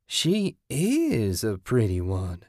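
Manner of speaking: slow, drawn-out and sly; purring. A narrator's reading. Lightly voiced woman admires the wife, slow sly purr. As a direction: savour each word like a cat. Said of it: "Kathy purred".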